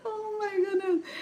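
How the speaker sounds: high laughing exclamation; giggly oh my goodness. Real, not acted. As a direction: laugh it out in delight. Laughing at the memory of the show.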